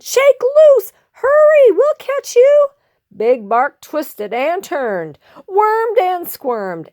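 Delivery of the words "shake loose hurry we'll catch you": high, loud squeaky shouts; urgent shouting. Acted. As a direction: shout help up to a friend. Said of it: "the prairie dog shouted".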